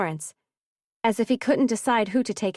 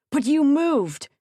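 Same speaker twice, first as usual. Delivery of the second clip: higher and louder, a hard shout; 6 semitones higher, 5 dB louder, slower than usual. A narrator's reading. Shen yelling at the man he tried to kill, angry shout. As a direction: fling it out in anger. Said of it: "Shen shouted".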